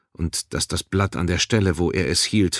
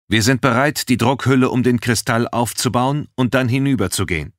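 higher voice, a touch testy; slightly irritated; 6 semitones higher, 4 dB louder than usual. A narrator's reading. First officer reports over radio, slightly irritated. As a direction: report with mild impatience. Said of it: "Seine Stimme klang leicht gereizt".

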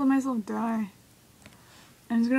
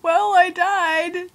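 exclaimed defeat; 6 semitones higher, 5 dB louder, much slower than usual. Real, not acted. Reacting to own game death.

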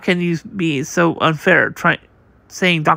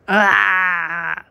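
short crying shout; cry of alarm. Acted. A crewmate cries out as the fire spreads, alarmed cry. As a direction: cry out as the flames catch.

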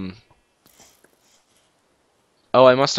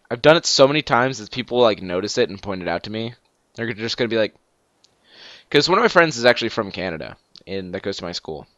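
ordinary talk, nothing marked

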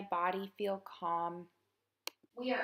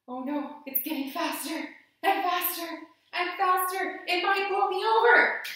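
from worried to loud alarmed cries, higher and louder; playful mounting alarm as the storm grows; building alarm; 6 semitones higher, 8 dB louder than usual. Pretend. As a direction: pretend the wind is knocking you over, rising panic. Acted wind storm in tree pose.

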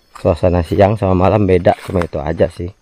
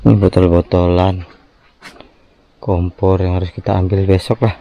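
ordinary talk, nothing marked

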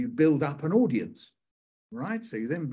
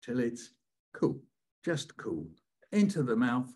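put-on reading aloud to a crowd of kids; poem performance; 5 semitones higher than usual. Shown as a demonstration. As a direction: perform the brother's turn in the poem. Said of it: "can you say nice".

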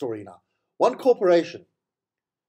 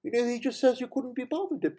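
higher-pitched, lightly imitated woman mentioning it in passing; matter-of-fact older woman; 8 semitones higher than usual. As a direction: retell her casual remark in a raised voice. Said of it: "mentions matter-of-factly".

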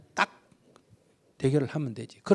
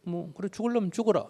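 calm parent's retort; 7 semitones higher, 5 dB louder than usual. Retold or imitated. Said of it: "이렇게 이제 나가면".

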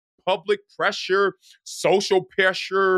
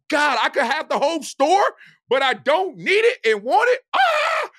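high, exuberant outburst peaking in a cry; overwhelmed retold excitement; 10 semitones higher, 3 dB louder than usual. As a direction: act out being thrilled by endless possibility. Acts out overwhelm in the store.

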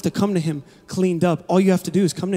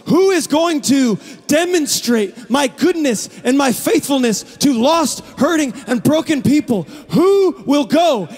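slow, higher, booming call from the throne; God calling from His throne, slow and booming; 5 semitones higher than usual. Acted. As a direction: call out slowly as God seeking a messenger. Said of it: "It's a booming voice".

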